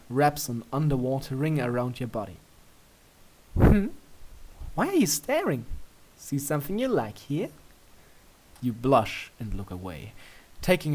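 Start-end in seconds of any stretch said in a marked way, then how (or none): 3.5-7.5 high, coy teasing voice; teasing princess. A narrator's reading. Luna teasing you about staring, high and coy. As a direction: tease him, lilting up high.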